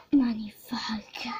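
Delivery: slow, breathless whisper of wonder; awed whisper. Real, not acted. Girl admires her new horse, whispering in awe.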